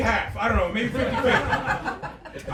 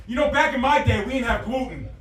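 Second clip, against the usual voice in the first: dad voice; 4 semitones higher, 6 dB louder than usual. Retold or imitated. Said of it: "it just turns into".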